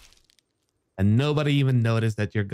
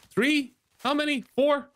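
rising astonishment, very high; counting in disbelief; slower than usual. Real, not acted. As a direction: voice jumps high as each one appears. Counting more and more enemies.

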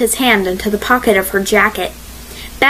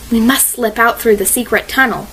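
ordinary delivery

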